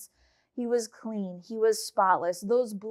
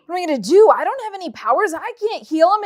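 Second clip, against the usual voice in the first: panicked king; 4 semitones higher, 8 dB louder, much faster than usual. Acted. King panicking over Naaman's healing.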